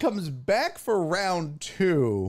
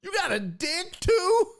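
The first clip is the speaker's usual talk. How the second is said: mock surprise; 12 semitones higher than usual. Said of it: "It's like".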